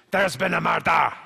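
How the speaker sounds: slow, loud Scottish murder line; hammed-up Glasgow detective; Scottish accent. Shown as a demonstration. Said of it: "the Scottish accent".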